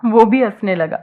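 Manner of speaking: ordinary delivery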